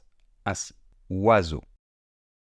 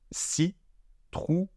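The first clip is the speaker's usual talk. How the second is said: slow, exaggerated; 10 semitones higher, much slower than usual. Shown as a demonstration. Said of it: "Donc je répète".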